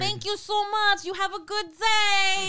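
put-on high, loud, stretched Staten Island kid; slow singsong Staten Island imitation; strong Staten Island accent. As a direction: lay on a thick Staten Island drawl. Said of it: "that really strong".

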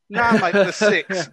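loud, drawn-out mock argument; heated retort. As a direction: throw it back loudly, stretching the words. [name] arguing back in heated row.

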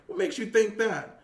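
indignant question, retold; retold snapping. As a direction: retell it as a fed-up comeback. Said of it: "something snapped in me".

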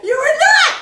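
high, loud shocked cry; shocked disbelief. Real, not acted. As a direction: yell in alarm at being recorded. Shock at being recorded.

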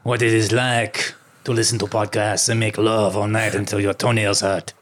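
hammed-up French accent. Co-host playing a Frenchman scolding podcasters, French accent. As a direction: play a haughty French lover, French accent. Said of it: "You should have done French".

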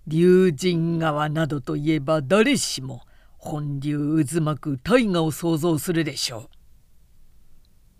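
hoarse guide addressing his visitors. A narrator's reading. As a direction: explain in a husky, rasping voice. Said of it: "久保大六がしゃがれ声で言った". (しゃがれ声で説明する)

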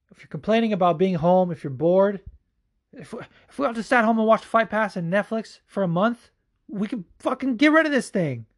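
rising to a high, near-shouted plea to stay home; fired-up rant. Real, not acted. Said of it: "that is the fucking energy I'm bringing".

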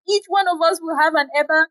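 high, excited chorus of women; women crying out. A narrator's reading. Eager women calling out their delight together. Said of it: "the women cried out".

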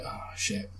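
breathy whispered curse. Retold or imitated. Man finding his slashed tyre, whispering a curse. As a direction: whisper it under your breath.

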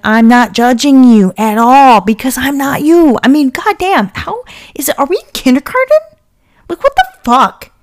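fed up and heating up fast; real anger building; getting angry. Real, not acted. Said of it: "I'm getting angry".

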